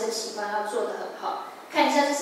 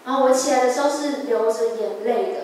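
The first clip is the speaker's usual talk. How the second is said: usual speaking voice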